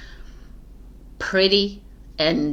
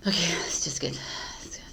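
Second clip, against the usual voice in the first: whisper; 7 dB quieter, faster than usual. Real, not acted. Restarting the recording, muttering.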